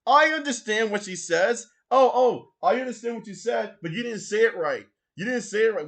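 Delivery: hammed-up indignation over a name; mock outrage.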